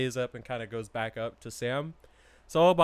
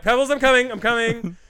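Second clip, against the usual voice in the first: higher and louder call to the dog; hurried mock call to the dog; 7 semitones higher, 8 dB louder than usual. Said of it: "So it's like".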